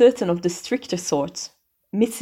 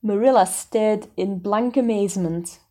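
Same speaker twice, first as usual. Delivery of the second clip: ordinary delivery, nothing marked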